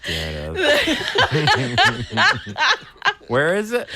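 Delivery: drawn out, dissolving into laughter; can barely get it out for laughing; laughing slowly. Real, not acted. Breaks up laughing while repeating.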